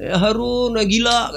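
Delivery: high taunting call; mocking call. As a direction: throw the insult back high. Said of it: "kata dia". (ejekan tinggi)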